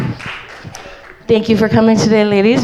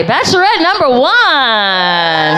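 booming pumped announcer; 6 semitones higher, much slower than usual. Acted. Host introducing contestant.

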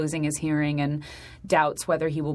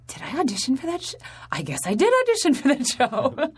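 high-pitched mock bewilderment, rising into realization; baffled, high voice breaking into amusement; bewildered reaction; 8 semitones higher than usual. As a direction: act out a puzzled double take. Acting out own bewildered reaction.

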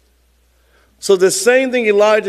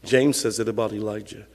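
normal talking voice

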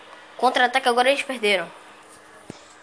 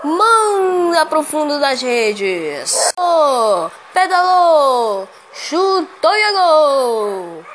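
drawn-out goal yell; explosive goal commentary; 6 semitones higher, 6 dB louder, much slower than usual. Real, not acted. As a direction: stretch the goal shout long and loud. Benfica equaliser commentary.

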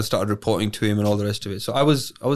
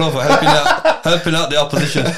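laughing; 4 semitones higher, 6 dB louder than usual. Real, not acted. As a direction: thank him through laughter. Laughing sarcastic thanks.

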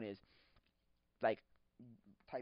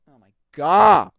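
a drawn-out, much louder oh my God; slow loud exclaim; 4 semitones higher, 22 dB louder, slower than usual. Retold or imitated. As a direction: let it out slow and loud in disbelief. Reacting to the frozen computer.

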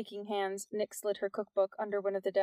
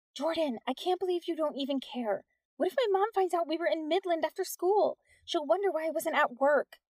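high, agitated worrying; fret, pitched well up; mild panic; 8 semitones higher than usual. A narrator's reading. Nix to Jordan, fearing mom will find out, panicky. Panicking girl voiced in story.

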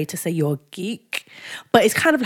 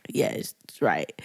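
deep low friend voice; 10 dB quieter than usual. Retold or imitated. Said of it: "she'd be like".

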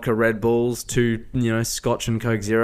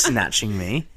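ordinary delivery

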